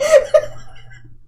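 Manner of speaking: very high shrieking giggle; squealed giggle; high squealing laugh. Real, not acted. Cracks up.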